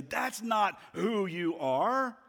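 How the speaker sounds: high, slow, emphatic refutation; Jesus. Acted. Answering the accuser's lie, slow and emphatic. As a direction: proclaim it slowly, leaning on the denial. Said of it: "hear the voice of Jesus in that moment say".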